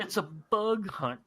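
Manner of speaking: stretched-out, hammed quote; Hudson movie quote. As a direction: echo the film marine's line, slowly. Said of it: "one of the quotes was from Hudson".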